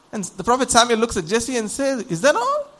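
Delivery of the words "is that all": high-pitched, rising disbelief; acted surprise, voice leaping up; puzzled questioning. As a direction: ask it as a baffled prophet. Samuel puzzled, questions Jesse.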